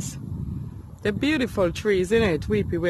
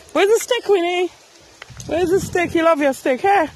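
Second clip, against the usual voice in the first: dog talk; 7 semitones higher than usual. Real, not acted. Talking to the dog.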